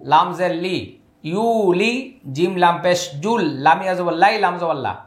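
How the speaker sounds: normal talking voice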